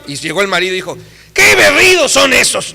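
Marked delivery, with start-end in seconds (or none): 1.3-2.8 higher, louder, slow mocking scorn; mock contemptuous husband; scornful husband. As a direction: sneer at her singing. Husband mocking wife's singing.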